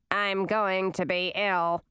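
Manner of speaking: slow, put-on queenly mutter; disgusted aside. A narrator's reading. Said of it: "muttered Olivine".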